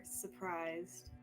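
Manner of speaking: ordinary talk, nothing marked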